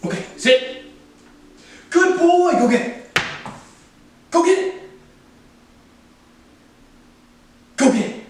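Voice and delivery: sing-song praise for a puppy; bright coaxing voice for the dog; high-pitched dog talk. Real, not acted.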